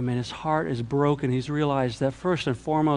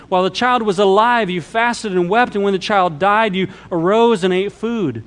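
higher, louder declaimed Bible narration; emphatic scripture reading; 5 semitones higher, 5 dB louder than usual. A narrator's reading. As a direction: read the passage out with raised, driving voice.

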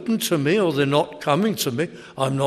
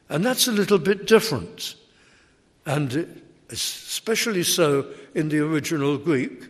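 ordinary talk, nothing marked

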